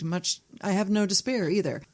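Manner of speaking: usual speaking voice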